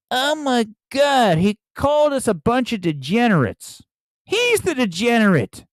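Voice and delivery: hammed-up scandalized online critic; mock an offended crowd in a high voice; mock outrage. Mocking outraged film Twitter reaction.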